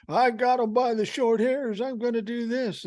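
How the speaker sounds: high, cocky mock voice of Tuberville; mock tough-guy boast. Mocking Tuberville boasting to listeners, high cocky voice. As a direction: ham up a smug senator bragging, pitched high.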